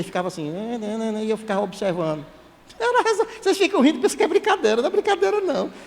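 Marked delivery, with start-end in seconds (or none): 2.8-5.7 protesto animado. Real, not acted. Responde aos risos do público.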